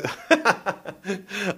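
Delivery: real laugh at own fluff; breaking into laughter. Real, not acted. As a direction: crack up mid-take. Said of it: "and one more time".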